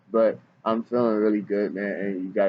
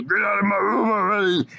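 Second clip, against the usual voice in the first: cartoon character voice; 11 semitones higher than usual. Shown as a demonstration. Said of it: "I have to say a bunch of stuff like this".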